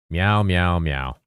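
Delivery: short run of meows, dropping in pitch; meowing on request. Pretend. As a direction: meow at the voice detector. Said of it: "should meow more often".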